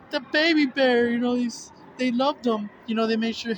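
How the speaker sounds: hammed-up weepy high voice; mock teary gushing. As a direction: imitate yourself welling up at a sappy ad. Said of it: "I was like".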